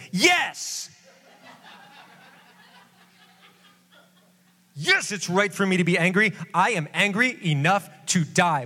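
high, insistent sulk; defiant Jonah. Acted. Jonah retorting to God, high and defiant. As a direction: dig in and insist you're right.

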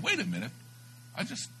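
very high-pitched, mock-baffled interruption; squeaky retold double take; startled objection. Startled reply to convert's tongues.

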